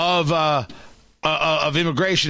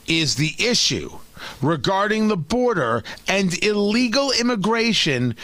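normal talking voice

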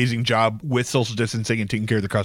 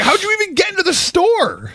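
incredulous mock. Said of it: "I was like".

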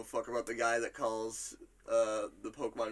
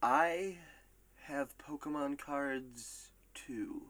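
slow, spaced-out reading, dropping softer at the end; drawn-out quote; 4 dB quieter, much slower than usual. Acted. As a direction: stretch each phrase with pauses between. Reads a player's quote drawn out.

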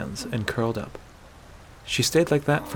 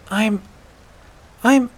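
high, halting false starts; agitated hesitation; 10 semitones higher, 4 dB louder than usual. Acted. Aela struggling to confess, hesitant. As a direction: stall twice, unable to get it out. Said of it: "in agitation".

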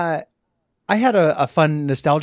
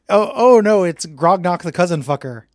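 mock dread; 4 semitones higher, 7 dB louder than usual. Mock dread at the villain's return.

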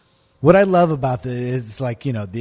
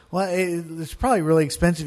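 quick objection; 5 semitones higher, faster than usual. Retold or imitated. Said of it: "And he goes".

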